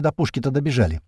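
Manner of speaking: ordinary delivery, nothing marked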